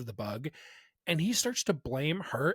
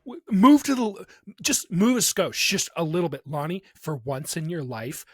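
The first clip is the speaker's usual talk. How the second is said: louder, pushing a stuck teammate; frustrated urging; 2 semitones higher, 6 dB louder than usual. Retold or imitated. Coaching Lonnie to move, frustrated loud urging. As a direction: bark orders at a frozen partner.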